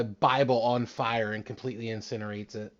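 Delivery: ordinary talk, nothing marked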